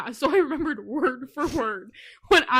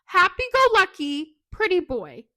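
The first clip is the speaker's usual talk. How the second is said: worked-up, higher and louder protest; indignant rant; 5 semitones higher, 5 dB louder than usual. Real, not acted. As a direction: rant in laughing outrage, pitched up. Mock-upset rant, laughing listeners.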